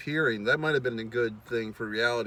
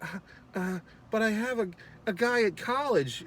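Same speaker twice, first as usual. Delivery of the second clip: high, hesitant imitation of a young woman; flustered girl; 8 semitones higher than usual. Girl dodging his kiss, high flustered voice. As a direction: stammer the excuse in a high girlish voice. Retold girl fending off a guy.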